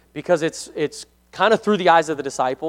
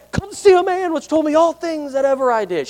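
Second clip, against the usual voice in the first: excited woman; 11 semitones higher than usual. Acted. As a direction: announce it like she can't contain herself. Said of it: "She was so excited".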